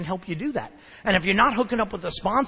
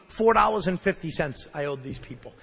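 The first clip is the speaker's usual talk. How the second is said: normal talking voice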